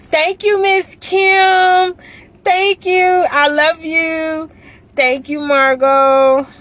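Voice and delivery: high, loud, drawn-out squeal of thanks; excited thanks. Real, not acted. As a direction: call out gratefully to each friend, stretching the words. Greeting viewers by name, thanking them.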